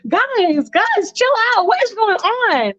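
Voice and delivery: high, light voice telling the guys to chill; Ming hushing the boys, high and light; high calming girl. Retold or imitated. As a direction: soothe the boys in a high girly voice. Ming calms the quarrelling boys.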